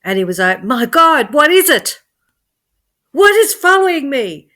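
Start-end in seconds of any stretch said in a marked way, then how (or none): 0.6-1.3 high, loud panicked outburst; panicked cry. Acted. Joe chased by a strange light, panicking. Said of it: "he started to panic". 1.3-2.0 much higher and louder, frantic; panicked cry. Acted. Joe, unable to shake the light, crying out in panic. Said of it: "he started to panic". 3.1-4.5 much higher and louder, frantic; panicked cry. Acted. Joe, unable to shake the light, crying out in panic. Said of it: "he started to panic".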